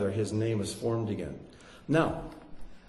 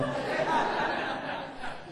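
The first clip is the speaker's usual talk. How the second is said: soft breathy laugh; 5 dB quieter than usual. Real, not acted. Laughs at own joke about sister-in-law.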